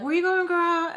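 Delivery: lilting high question; faux-concerned friend at a party; high fake-friendly call. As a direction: sing it out sweetly. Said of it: "she is like".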